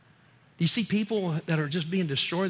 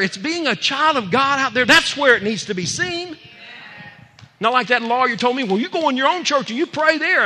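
loud, high-pitched sermon delivery; fired-up preacher ringing out; full-force preaching; 10 semitones higher, 8 dB louder than usual. Acted. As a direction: preach it hard, voice raised.

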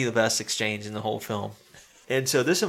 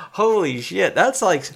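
higher, louder stunned exclaim; retold amazement, louder and higher; shocked; 7 semitones higher, 6 dB louder, slower than usual. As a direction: blurt it out in disbelief. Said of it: "I was like".

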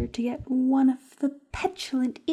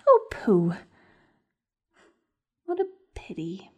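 lower, hushed mock regret; mock pity whisper; 7 semitones lower than usual. Schemer mourns his lost scouts in a whisper. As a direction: sigh it out in a whisper.

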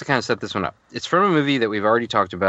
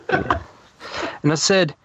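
higher, drawn-out mock Irish; put-on Irish accent; 6 semitones higher, much slower than usual. Podcaster restarting a letter reading, put-on Irish accent. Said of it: "I was gonna try to do an accent".

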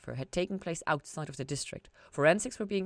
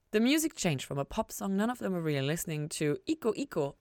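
plain reading voice

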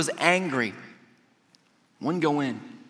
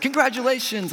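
high, celebratory cheer; retold congratulations; 8 semitones higher than usual. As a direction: imitate staff congratulating a winner. Retold clapping staff congratulate them.

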